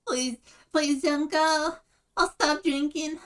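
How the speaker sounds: high, loud, hammed-up pleading; tearful mock begging. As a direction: beg pathetically in a put-on high, desperate voice. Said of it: "Tears and begging".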